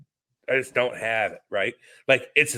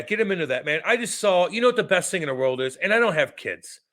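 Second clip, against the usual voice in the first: usual speaking voice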